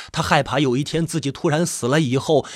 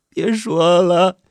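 high, loud, drawn-out wail; slow, crying plea; tearful pleading; 5 semitones higher, 5 dB louder, much slower than usual. A narrator's reading. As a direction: cut him off through sobs. Sobbing wife cuts off his apology.

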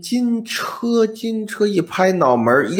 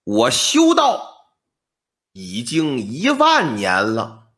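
slow solemn reveal; 6 semitones lower, slower than usual. Acted. Old man's solemn revelation.

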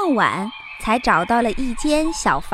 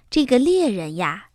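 ordinary delivery, nothing marked

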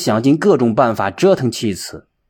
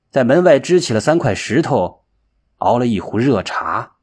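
plain reading voice

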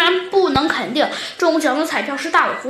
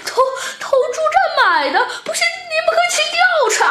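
very high, stammering, tearful protest; frantic, nearly crying; 13 semitones higher, much faster than usual. A narrator's reading. Fox denying lying to the police, frantic and nearly crying. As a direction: plead fast and squeaky, on the verge of tears. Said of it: "大尾狐急得都要哭出来了".

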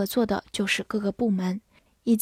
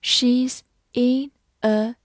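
slow, one word at a time; word-by-word model for learners to follow; slow read-through; slower than usual. Shown as a demonstration. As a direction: read it slowly with gaps between words. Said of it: "好，慢慢来读".

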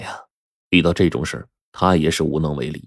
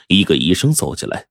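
plain reading voice

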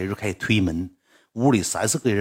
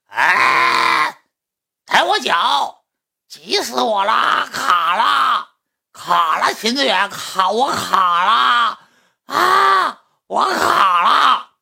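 imitated shrieking fangirl, very high; mock screams, drawn-out and high-pitched; howling scream; 12 semitones higher, 6 dB louder, much slower than usual. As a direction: wail it out loud, stretching the words. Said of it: "嗷嗷喊".

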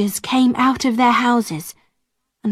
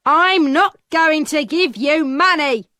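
irritated peddler; 5 semitones higher than usual. A narrator's reading. Peddler refusing Alison.

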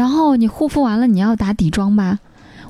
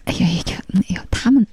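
whispered mock fretting; hushed worried nagging; imitating fussy boyfriend; faster than usual. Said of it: "每次我穿个什么衣服一出门就".

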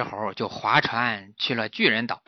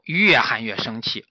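usual speaking voice

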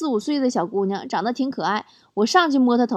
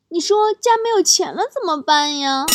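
lightly voiced small child, higher; little brother; 5 semitones higher than usual. Little brother asking big sister about money, light child voice. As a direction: imitate a five-year-old's worried question. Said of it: "五岁的弟弟问我".

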